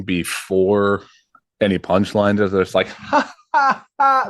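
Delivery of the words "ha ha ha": high, forced "ha ha ha"; mock loud laughter. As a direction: imitate obnoxious drunken table laughter. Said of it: "like, being really loud".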